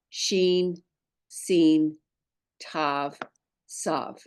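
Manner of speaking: one letter name at a time, pausing after each; measured roll call with a pause between names; call-and-repeat recitation. Shown as a demonstration. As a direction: recite the alphabet slowly for learners to echo. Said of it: "Say this with me".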